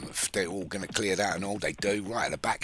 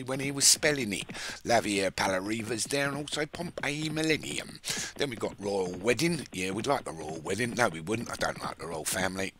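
ordinary delivery

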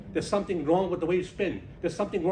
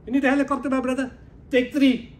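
much higher and louder, delighted to help; booming generous offer; 9 semitones higher, 7 dB louder than usual. Retold or imitated. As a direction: answer big and generous. Soldier offers helicopters.

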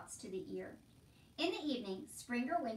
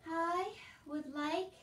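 slow, higher hesitant voice; shy; 6 semitones higher, 5 dB louder, much slower than usual. A narrator's reading. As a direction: venture it shyly. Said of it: "said Meg shyly".

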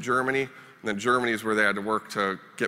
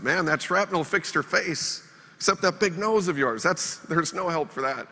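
higher, slow mock-sympathetic ribbing; a buddy's mock comfort, teasing; teasing friend; 7 semitones higher, slower than usual. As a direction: rib him about his nose, deadpan and slow. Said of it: "He's like".

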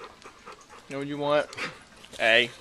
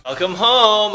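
loud greeting; 6 semitones higher, 10 dB louder, slower than usual. Real, not acted. Greeting an empty house.

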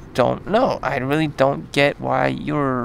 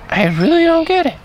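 much higher, squeaky exasperated outburst; mock-bewildered high-pitched whine; 12 semitones higher, 4 dB louder than usual. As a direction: throw up your hands in a high, baffled squeal. Exasperated rant about bossy women.

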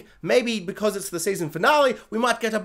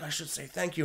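soft aside; 3 semitones lower, 11 dB quieter than usual. Retold or imitated. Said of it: "she's like".

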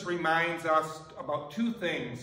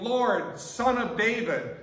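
higher, louder cry of a title; yelling; 6 semitones higher, 5 dB louder, slower than usual. Retold or imitated. Canaanite woman hails Jesus through crowd, yelling. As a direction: call out the name to catch his ear. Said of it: "And she's yelling".

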